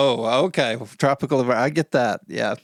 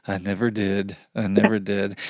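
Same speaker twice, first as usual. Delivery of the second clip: mock sad; 6 semitones lower than usual. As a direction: echo it with exaggerated sadness. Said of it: "she says with sadness".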